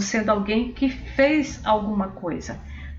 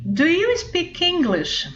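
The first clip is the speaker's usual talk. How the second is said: slow model question; 8 semitones higher, slower than usual. Shown as a demonstration. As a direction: do you speak English, slow and higher. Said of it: "eu quero falar assim". (pergunta modelo, devagar e mais aguda)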